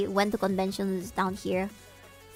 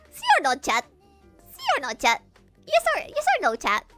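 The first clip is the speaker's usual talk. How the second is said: friends mimicking streamer; 5 semitones higher, 3 dB louder, faster than usual. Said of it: "they said".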